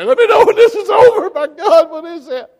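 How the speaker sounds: very high, loud, laughing panicky squeal; mock terrified squeal. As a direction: ham up a coward hiding in the closet. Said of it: "did I hide in the closet and say".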